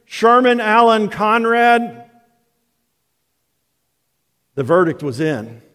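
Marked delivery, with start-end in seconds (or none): 0.1-2.1 slow, high, emphatic full-name summons; raised-voice scolding roll call of names; mom crying out. Retold or imitated. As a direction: imitate a mother's final warning, each name drawn out. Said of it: "her voice cry out".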